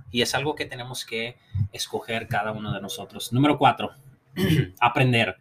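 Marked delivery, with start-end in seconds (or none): none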